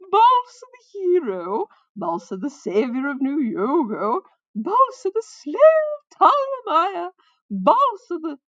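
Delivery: high, very loud minstrel proclamation; crying out. A narrator's reading. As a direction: hail the hero with long, swooping words. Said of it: "the newcomer cried".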